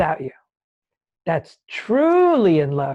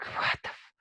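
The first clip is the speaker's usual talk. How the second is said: breathy under-the-breath curse, cut off; muttered curse; 13 dB quieter than usual. Retold or imitated. Stuck again in the snow, swearing softly to himself. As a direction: bite back the swear in frustration.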